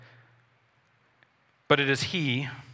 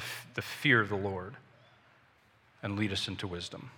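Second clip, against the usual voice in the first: soft, hushed prayer fading to a murmur; closing prayer; 4 dB quieter than usual. Acted. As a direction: pray humbly, letting the voice sink.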